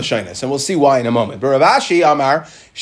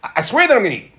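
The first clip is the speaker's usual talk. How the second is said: insistent refusal; 5 semitones higher, much faster than usual. Acted. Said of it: "and I said".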